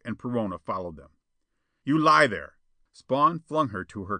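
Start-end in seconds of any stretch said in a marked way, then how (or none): 1.8-2.6 loud, higher-pitched command; angry order. A narrator's reading. Spawn flings Jetta to her couch, angry. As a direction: order it with force.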